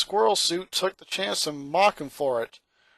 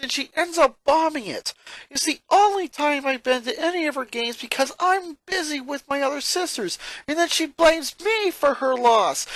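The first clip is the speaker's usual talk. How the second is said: higher, louder exasperated rant; boy's exasperated complaint, pitched up; frustrated; 11 semitones higher, 4 dB louder than usual. A narrator's reading. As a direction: vent the whole grievance. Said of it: "his frustration ever so clear".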